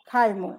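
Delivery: খাইমু said as the dialect form of খাবো; regional word form. Shown as a demonstration. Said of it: "কে বলে".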